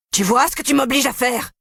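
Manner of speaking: yelling. A narrator's reading. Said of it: "hurle Nourayed".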